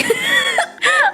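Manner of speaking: very high laugh, almost crying; high squealing laugh. Real, not acted. Laughing at the doctor.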